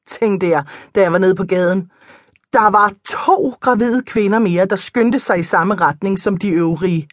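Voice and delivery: plain reading voice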